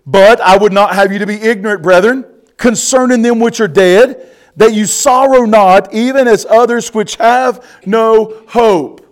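loud, ringing preacher's scripture read; booming pulpit reading. Acted. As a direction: proclaim the verse to the congregation. Preacher reads scripture aloud.

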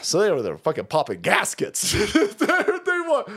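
ordinary delivery

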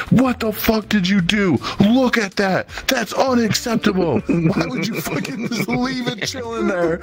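screaming mom. Retold or imitated. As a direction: ham up a mother losing it over the toilet. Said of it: "screaming at him".

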